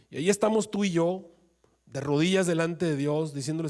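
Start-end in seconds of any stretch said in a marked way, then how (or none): none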